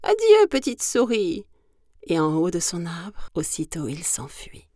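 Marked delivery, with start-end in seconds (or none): 0.0-1.4 high, loud parting cry; frightened owl's raised voice; panicked farewell. Acted. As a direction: call goodbye while fleeing. Frightened owl flees.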